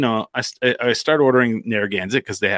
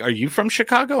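excited question; 12 semitones higher than usual. Retold or imitated. Excited guys asking about Chicago.

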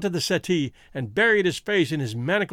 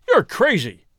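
loud, higher fierce outburst; fierce cry; 4 semitones higher, 6 dB louder than usual. A narrator's reading. Cornered man rejecting Holmes's news, crying out fiercely. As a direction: throw it out fiercely. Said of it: "he cried fiercely".

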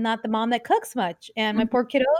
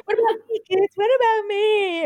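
higher and louder, slow drawn-out plea; mock whiny yell; 10 semitones higher, 6 dB louder than usual. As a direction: ham up a mom begging her kids for attention. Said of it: "I wanna yell too".

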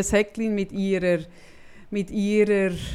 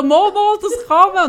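insistent; 8 semitones higher, 7 dB louder than usual. Retold or imitated. Insisting to the clerk.

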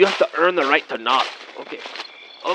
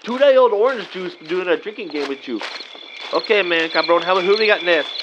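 ordinary talk

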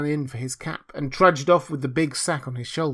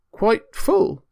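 higher-pitched, dismayed question; rather sorrowful; 8 semitones higher, 6 dB louder than usual. A narrator's reading. Hans faced with a very big basket, rather sorrowful. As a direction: echo it in plaintive dismay. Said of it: "rather sorrowfully".

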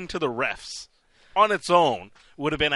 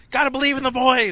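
fired-up fan; 9 semitones higher, 6 dB louder than usual. Retold or imitated. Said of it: "He's like".

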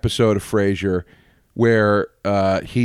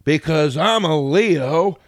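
mock trailer joke voice; 6 semitones higher, much slower than usual.

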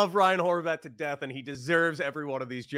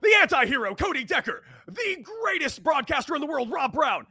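ringmaster; 9 semitones higher, 5 dB louder than usual. Pretend. Wrestling-style intro of hosts.

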